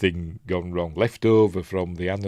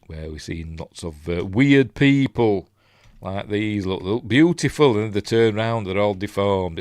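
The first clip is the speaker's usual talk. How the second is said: ordinary delivery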